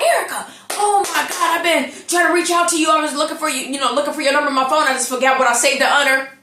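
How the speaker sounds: excited caller. Retold or imitated. Voicing excited man on phone.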